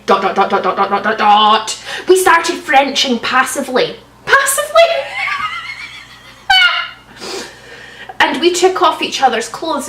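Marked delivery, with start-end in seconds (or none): 4.3-4.9 incredulous. Real, not acted. Incredulous at word. 4.9-5.9 high shrieking laugh. Real, not acted. Laughs at passively.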